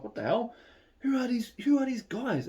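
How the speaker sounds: very high, incredulous questions; retold astonishment, pitch shooting up; amazed reaction. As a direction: act out stunned curiosity. Retelling amazed questions at the party.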